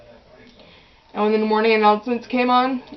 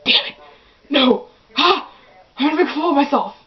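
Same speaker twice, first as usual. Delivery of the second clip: panicked thought; 4 semitones higher, faster than usual. Retold or imitated. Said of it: "I freeze, 'cause I'm like".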